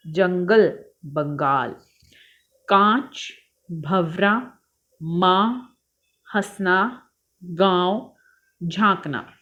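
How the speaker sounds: each word read slowly and separately, nasal sound clear; teacher reads पंखा, झंडा, ऊंट word by word for the class; careful nasal words. Shown as a demonstration. Teacher modelling each nasal word clearly for pupils. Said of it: "ध्यान से देखिए और बोलकर पढ़िए".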